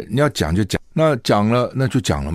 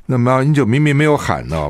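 ordinary delivery

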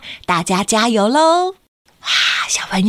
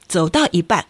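ordinary delivery, nothing marked

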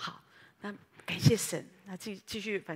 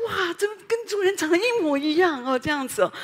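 higher, louder delighted exclamation; amazed; 7 semitones higher, 9 dB louder than usual. Retold or imitated. Preacher seeing a dog like its owner, amazed. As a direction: marvel at the lookalike dog. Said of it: "我说".

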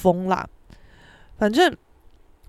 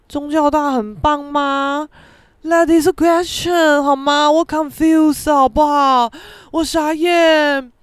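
high, loud, slow incredulity; dumbfounded; 5 semitones higher, 6 dB louder, slower than usual. Retold or imitated. Son inwardly questioning parents' pride, dumbfounded. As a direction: stretch out each disbelieving phrase.

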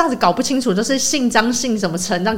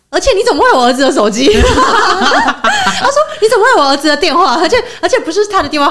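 quoting the landlady while laughing; laughing mid-retelling; laughing through quote; 8 semitones higher, 3 dB louder than usual. Retold or imitated. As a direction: giggle through the retold question. Retelling landlady's suspicious question.

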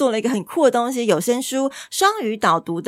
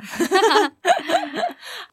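laughing; 11 semitones higher than usual. Real, not acted. Host laughing.

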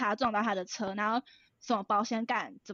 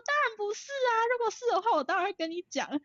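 much higher, mock-innocent protest; fake innocent denial; 10 semitones higher than usual. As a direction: play the innocent daughter caught lying. Lying innocently to her mom. (装无辜的高音否认)

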